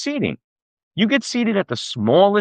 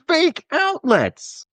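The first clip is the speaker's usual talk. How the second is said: outraged; 12 semitones higher, much slower than usual. Acted.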